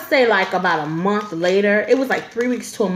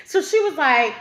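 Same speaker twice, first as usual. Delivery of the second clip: usual speaking voice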